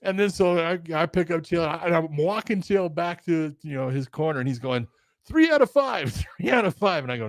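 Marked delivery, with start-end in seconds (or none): 5.3-7.0 high-pitched mock bargaining; pleading demand. Beaten fighter walked to corner, high insistent pleading. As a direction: imitate a sore loser pushing for more rounds.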